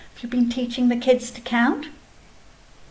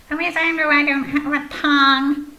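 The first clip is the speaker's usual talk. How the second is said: high, cheeky little snail voice counting; Snail puppet voice; 4 semitones higher, 4 dB louder than usual. Acted. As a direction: play a chirpy snail puppet showing off.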